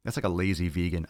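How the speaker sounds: ordinary talk, nothing marked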